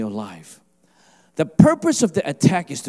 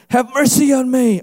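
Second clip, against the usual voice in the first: louder, higher acted plea; crying out; 7 semitones higher, 6 dB louder than usual. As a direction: call out for mercy over the crowd. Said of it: "So he cried out".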